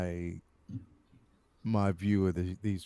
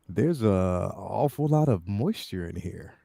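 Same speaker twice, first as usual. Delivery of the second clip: sleazy; 6 semitones lower, 6 dB quieter than usual. Pretend. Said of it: "The most sleazy and lascivious way possible".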